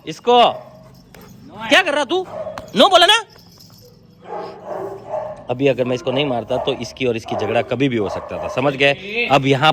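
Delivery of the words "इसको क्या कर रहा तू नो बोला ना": I said no, mean it; scolding the dog. Real, not acted. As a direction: owner scolding a disobedient dog.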